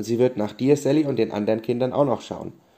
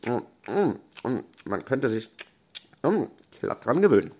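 talking through a mouthful; mouth full. Acted. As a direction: chew and speak with full mouth. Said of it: "machte auch zu ihm". (mit vollem Mund)

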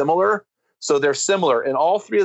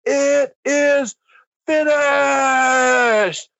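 war-cry scream; 11 semitones higher, much slower than usual. Shown as a demonstration. Preacher voicing Jesus on the cross, triumphant scream. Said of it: "Alpha and Omega male screamed".

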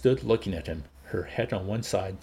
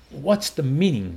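louder, higher demand; outraged; 6 semitones higher, 6 dB louder than usual. A narrator's reading. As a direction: demand an explanation. Outraged director begins to protest.

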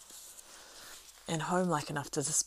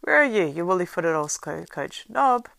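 loud, higher yelling through the inn; calling out loud; 3 semitones higher, 11 dB louder than usual. A narrator's reading. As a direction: bellow for the lazy servant. Landlord calling servant.

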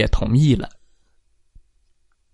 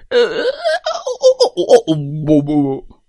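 scared whimpers; 9 semitones higher, faster than usual. Acted. Animals grabbed under giant's arm.

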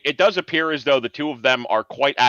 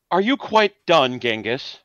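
put-on haughty, higher old-English toff voice; a snooty gent sneering at his rival; posh old gentleman; 5 semitones higher than usual. Older gentleman snipes at Genghis, haughty and high. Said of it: "the older gentleman kind of, like, says".

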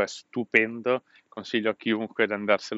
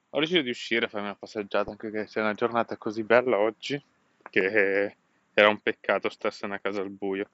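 ordinary delivery

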